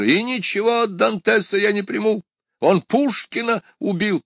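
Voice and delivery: very high-pitched yelled protest; yelling. A narrator's reading. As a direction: keep yelling, pitch right up. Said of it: "завопил из кабинета доктор". (возмущённый вопль на высокой ноте)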